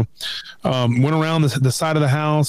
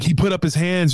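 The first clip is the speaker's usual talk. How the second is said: ordinary delivery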